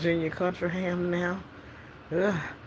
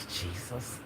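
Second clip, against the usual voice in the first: low, soft sigh of a plea; weary exasperation; 8 semitones lower, 4 dB quieter than usual. Acted. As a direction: sigh it out under your breath, fed up. Sighs at the caller's absurd problem.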